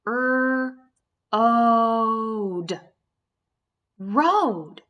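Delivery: ode then Road said loud and firm; long O blend. Shown as a demonstration. Teacher modelling long O for the class, loud blend. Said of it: "I'm gonna try the other vowel sound".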